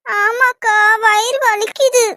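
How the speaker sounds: high, slow fake whine; mock-pained, drawn-out moan; feigned stomach ache. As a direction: whimper to big sister, obviously putting it on. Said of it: "என்கிட்ட நடிக்கிறியா".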